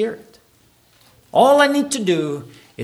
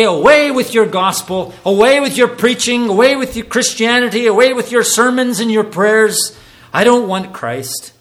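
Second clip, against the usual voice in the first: raised loud voice rejecting the gospel; mock defiant shout; 4 semitones higher, 5 dB louder than usual. Preacher voicing a scornful sinner, loud defiant shout. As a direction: fling it away loudly, higher and louder. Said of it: "Do you say".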